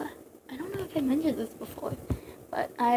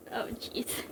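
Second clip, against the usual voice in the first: plain reading voice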